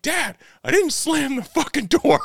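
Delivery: frustrated son. Retold or imitated. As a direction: squeak out the kid's outrage through a grin. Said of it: "and he's like".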